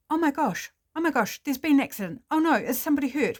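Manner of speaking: high, loud and fast, breathless panic; panicked alarm. Acted. Birdwatcher spotting a car crash, high fast panic. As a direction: blurt it out in a rush of shock. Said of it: "she goes into panic mode".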